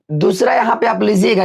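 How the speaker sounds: normal talking voice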